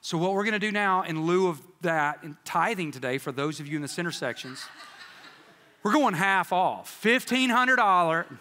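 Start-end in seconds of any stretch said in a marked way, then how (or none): 5.8-8.3 mock sales pitch. Joking tithe price to congregation.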